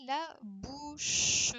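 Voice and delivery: "la bouche" stretched out slowly; slow, drawn-out answer. Shown as a demonstration. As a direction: draw out the answer for the children. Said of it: "réponse détachée lentement". (réponse « la bouche » très lente et appuyée)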